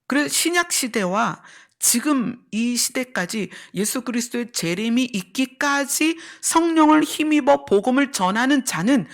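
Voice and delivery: ordinary talk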